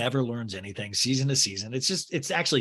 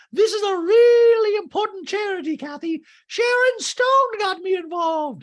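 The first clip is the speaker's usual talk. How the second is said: very high, loud, hammed-up indignation; drawn-out squawk of self-importance; mock outraged shriek; 8 dB louder than usual. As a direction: wail it like an offended charity patron. Doreen defends her charity.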